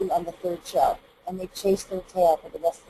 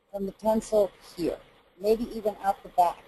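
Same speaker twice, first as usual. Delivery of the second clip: ordinary talk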